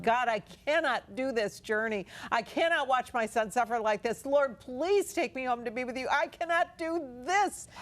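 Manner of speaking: desperate plea, voice straining high at the end; anguished prayer. Retold or imitated. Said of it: "And I just sobbed".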